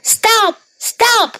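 very high calls to halt; crying out. A narrator's reading. Hungry cow calls to the runaway, high-pitched cry. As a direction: call out high, word by word. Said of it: "cried the cow".

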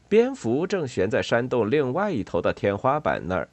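usual speaking voice

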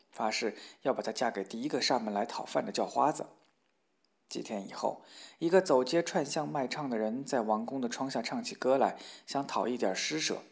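ordinary delivery

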